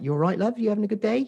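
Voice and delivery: lightly voiced cheery cashier greeting a customer; friendly shop lady. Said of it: "she was like that".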